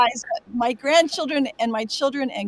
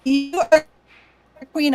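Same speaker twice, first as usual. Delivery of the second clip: high, louder gushing shout-out; excited praise; 5 semitones higher, 4 dB louder, slower than usual. Real, not acted. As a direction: gush over her like a fan. Praising fellow panelist.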